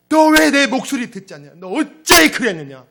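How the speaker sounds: high, exasperated boss's shout; loud lament. Acted. Boss back to a company in chaos, loud lamenting shout. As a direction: cry out in dismay at the wreckage. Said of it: "이렇게 탄식하는 거예요".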